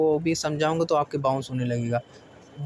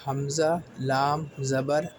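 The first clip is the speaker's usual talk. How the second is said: drawn-out letter names for learners; slow spelling out; much slower than usual. Shown as a demonstration. Teacher spelling letters slowly for beginners. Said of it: "سب سے پہلے".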